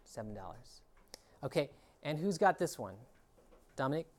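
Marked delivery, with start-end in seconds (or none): 0.0-0.8 hushed aside, almost no voice; whispered. Real, not acted. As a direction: whisper the answer. Teacher whispers answer.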